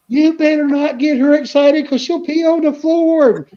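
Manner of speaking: high, slow mock mom scolding; mom warning. Mom warning about the dog.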